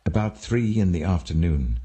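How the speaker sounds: ordinary delivery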